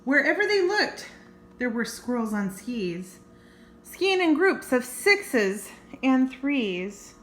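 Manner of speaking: lively read-aloud storytelling; story narration. A narrator's reading. Reading a squirrel picture book to kids, storytelling voice.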